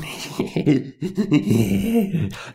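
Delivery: put-on drawn-out old man's snicker; sly wheezy giggle; old man's chuckle. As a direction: snicker to yourself as the old man. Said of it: "hvorfor griner du lidt".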